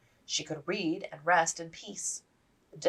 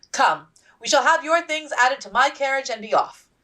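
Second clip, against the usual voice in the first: brisk, louder, higher orders; commanding; 9 semitones higher, 10 dB louder than usual. Acted. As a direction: take charge like an officer's wife. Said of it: "issued orders like the practiced officer wife".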